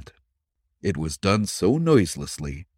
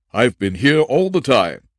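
loud, higher protesting voice; suspect's raised defensive denial; indignant suspect; 5 semitones higher, 6 dB louder than usual. Acted. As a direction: insist hotly on innocence. Jones protesting innocence.

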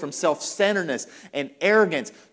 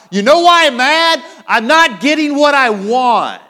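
shouted out loud, much higher and full-voiced; angry outburst; 9 semitones higher, 13 dB louder than usual. Voicing the inner anger of an argument, loud angry outburst. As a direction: let the frustration explode in a high yell. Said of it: "just say it out loud".

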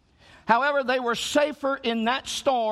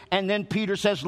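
normal talking voice

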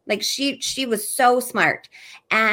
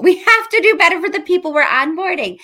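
louder and higher, urgent; emphatic; 7 semitones higher, 8 dB louder than usual. Real, not acted. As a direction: push it harder, with a laugh. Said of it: "repeated with more force".

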